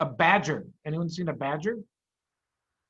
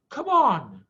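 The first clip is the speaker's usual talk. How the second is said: higher, louder impatient push; Missus Fox urging; 7 semitones higher, 7 dB louder than usual. Acted. Mrs Fox urges her husband, impatient. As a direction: hurry him along. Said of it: "said Missus Fox".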